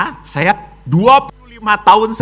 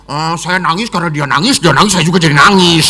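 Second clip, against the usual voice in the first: put-on child's excuse; faster than usual. Child explains crying.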